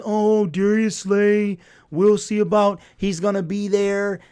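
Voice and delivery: hammed-up imitation of skeptical fans; mocking the doubters. Mocking what everybody was saying.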